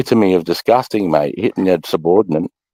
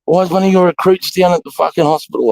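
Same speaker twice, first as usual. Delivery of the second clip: commanding officer's reprimand; 11 semitones higher than usual. Retold or imitated. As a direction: imitate an officer demanding explanations. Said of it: "the C O's going".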